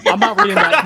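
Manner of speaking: laughing refusal. Real, not acted.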